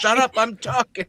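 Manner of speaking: put-on loud little old lady. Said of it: "that little old lady that won't whisper".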